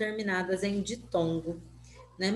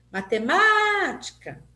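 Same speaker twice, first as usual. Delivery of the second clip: MÁ in "Matemática" long, high and loud; "Matemática" called out with a held MÁ; calling out, MÁ prolonged; 8 semitones higher, 5 dB louder, slower than usual. Shown as a demonstration. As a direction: call "Matemática" like a name, stretching the third syllable. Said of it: "como se essa palavra fosse uma pessoa que eu estivesse chamando".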